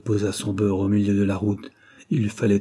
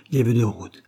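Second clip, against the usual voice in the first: ordinary delivery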